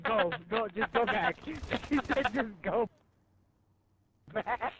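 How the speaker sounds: ordinary delivery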